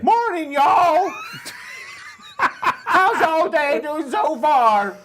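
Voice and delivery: high, loud, slow Southern drawl with laughter; over-the-top American accent. Acted. Cohost asks him to redo the chat message, hammed-up American accent. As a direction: ham up a Texan cowboy greeting. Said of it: "try again with an American accent".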